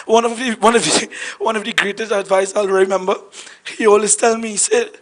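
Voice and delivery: ordinary talk, nothing marked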